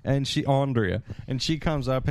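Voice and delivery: normal talking voice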